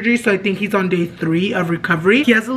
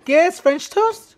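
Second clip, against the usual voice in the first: surprised; 9 semitones higher than usual. Real, not acted. Surprised at the food.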